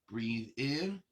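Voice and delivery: ordinary talk, nothing marked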